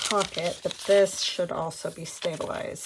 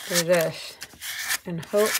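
mouth full; 3 dB louder than usual. Real, not acted. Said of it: "sorry, I got the pin in my mouth".

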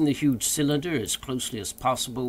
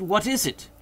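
high, eager question; raised, excited voice; highly delighted; 9 semitones higher than usual. A narrator's reading. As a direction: ask with keen delight. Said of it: "highly delighted".

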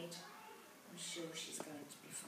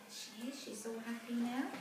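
ordinary delivery, nothing marked

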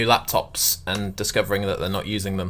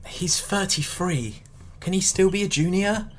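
Nando's staff doubting him; 9 semitones higher, 5 dB quieter, slower than usual. Retold or imitated. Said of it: "they look at me like".

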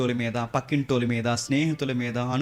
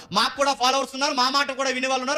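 high, fast indignant bragging; boastful protest; 11 semitones higher, faster than usual. Acted. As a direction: protest in a rushed, high-pitched brag. Acts Korah's boastful protest. (గొప్పలు చెప్పుకుంటూ ఎదురుతిరగడం)

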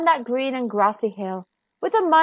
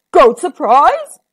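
troll perks up at the idea; troll surprised; 3 semitones higher, 8 dB louder, slower than usual. A narrator's reading. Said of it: "said the Troll".

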